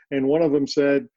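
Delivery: normal talking voice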